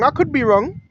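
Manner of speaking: ordinary talk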